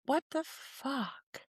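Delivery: slow stunned whisper of disbelief. Retold or imitated. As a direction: breathe it out in shock. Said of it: "I was like".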